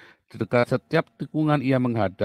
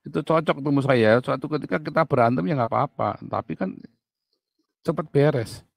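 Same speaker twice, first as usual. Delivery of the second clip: ordinary delivery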